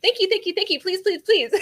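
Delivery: high, loud mock begging; mimicking her own high, loud, nonstop prayers back at her; mocking her pleading. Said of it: "All you do is yap at me".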